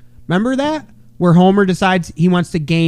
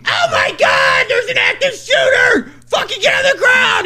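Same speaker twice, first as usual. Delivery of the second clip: mock panic. Crowd panicking in the gym, mock shouting. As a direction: freak out like the crowd. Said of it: "everybody freaks out".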